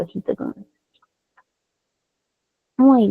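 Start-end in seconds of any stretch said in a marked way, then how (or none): 0.0-0.8 quieter, softer repeat of ojito con eso; soft repeated warning. Real, not acted. (repetición en voz baja de ojito con eso)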